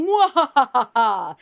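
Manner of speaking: loud, high, drawn-out evil laugh; Keke Palmer villain cackle. Acted. Villain Keke Palmer lurking in the back, evil cackle. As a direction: cackle like a movie villain, big and slow. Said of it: "pretty good Keke Palmer impression".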